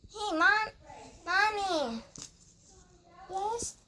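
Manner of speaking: put-on high childish doll calling mom; playful high kid voice; little kid doll voice. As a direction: call out to mommy as a little kid. Child doll calls her mom.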